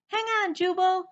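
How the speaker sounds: high, loud urgent call; anxious call. Acted. Rescuer calling anxiously to Jubal from the sailboat, loud and high. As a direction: urge him to hold on. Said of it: "an anxious voice called".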